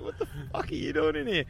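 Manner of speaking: high, shocked auntie voice; mock-outraged aunt catching him. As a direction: squeal it like a scandalised auntie. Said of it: "She's like".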